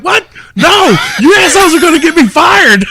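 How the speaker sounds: high, loud laughing panic; panicked yelling. Retold or imitated. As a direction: yell in mock horror, laughing. Panicked reaction on stage.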